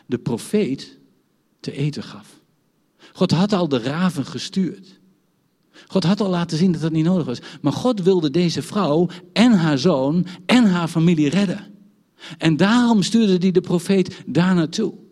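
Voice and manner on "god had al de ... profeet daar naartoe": louder, driving sermon delivery; preaching. Acted. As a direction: press the point home to the congregation. Preacher builds to full force.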